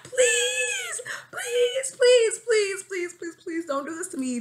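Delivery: high, loud wailing begging; hammed-up pleading. Begging crush not to friend zone them, hammed-up pleading. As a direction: beg in a mock wail, very high.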